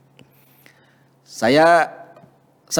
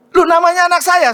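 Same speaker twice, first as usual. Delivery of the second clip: high, loud cry of surprise; sudden delighted surprise; surprised; 13 semitones higher, 5 dB louder than usual. Retold or imitated. Finds daughter's name at first. (kaget gembira, suara tinggi)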